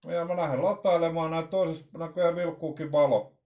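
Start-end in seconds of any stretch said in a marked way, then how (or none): none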